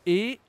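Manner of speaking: E as a high, closed é with a rising pitch; sharp rising closed é on E; closed French é. Shown as a demonstration. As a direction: say E as "ay" without the glide, voice lifted. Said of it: "accent aigu, c'est E".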